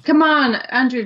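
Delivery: loud urging. Real, not acted. Urging Andrew to the microphone.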